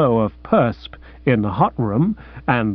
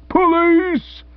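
high, yipping call for police; old man's high yelp; yipping in the tenor clef; much slower than usual. Acted. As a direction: yelp like a small terrier. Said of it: "yipped old Bassett up in the tenor clef".

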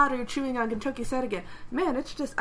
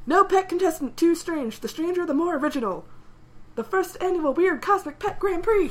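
higher, louder game-show announcer; TV host hype; 7 semitones higher, 5 dB louder than usual. Retold or imitated. TV host announces the weird pet contest, higher hyped announcer voice. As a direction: hype the grand prix like a host.

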